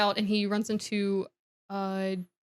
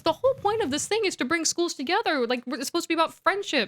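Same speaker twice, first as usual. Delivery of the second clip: higher, insistent arguing; mock indignant protest; 7 semitones higher than usual. Girl arguing with friend at the dance, imitated indignation. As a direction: plead the case in a raised pitch. Acted quarrel, Hermione protesting.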